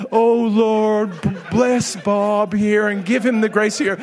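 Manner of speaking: pious prayer, half laughing through it; mock prayer. Pastor imitating the others praying over feet, laughing. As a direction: parody the solemn praying while stifling a laugh. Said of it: "everybody is over there praying".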